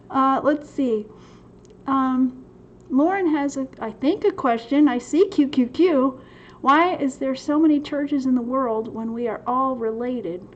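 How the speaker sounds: ordinary talk, nothing marked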